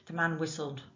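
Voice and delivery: ordinary delivery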